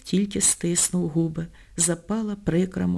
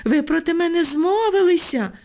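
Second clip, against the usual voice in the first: boiling over; 9 semitones higher than usual. A narrator's reading. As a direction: flare up at the laughter. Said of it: "скипіла Ніночка від їхнього сміху".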